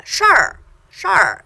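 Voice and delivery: "shar" with the tongue curled back on the r; retroflex shar. Shown as a demonstration. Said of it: "give it a little extra retroflex sound".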